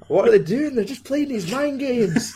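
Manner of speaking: high mock complaint. Podcaster mimicking exasperated film characters, high mock protest. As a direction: whine it up high, in mockery.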